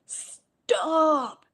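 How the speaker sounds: high, abrupt mock shout; retold sudden shout. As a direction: call a halt out of nowhere, imitating him. Retold companion suddenly halts her.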